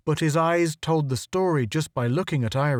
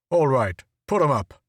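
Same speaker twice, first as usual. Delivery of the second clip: harsh guard voice. A narrator's reading. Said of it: "a new harsh voice cried".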